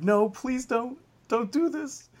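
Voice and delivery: high, pleading plea. Retold or imitated. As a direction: beg them not to, high. Pleading with wrestlers on TV.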